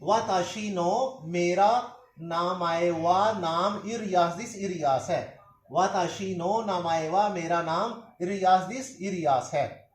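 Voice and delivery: each Japanese word slowly, then its meaning; walk them through it slowly word by word; slow review with glosses. Shown as a demonstration. Teacher to students, slowly repeating the whole lesson. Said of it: "सारे को दोबारा repeat करता हूँ".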